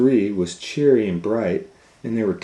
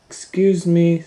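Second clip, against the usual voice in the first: put-on higher child voice; Kate, polite; 11 semitones higher, 8 dB louder than usual. Kate interrupts.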